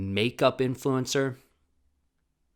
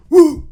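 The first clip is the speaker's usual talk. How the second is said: whoop; 22 dB louder than usual. Real, not acted. Cheering himself on.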